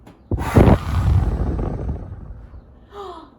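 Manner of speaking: long loud huffing blow; loud wolf blowing at the brick house; huge blow. Pretend. Reader blows again.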